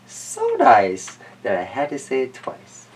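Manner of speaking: ordinary delivery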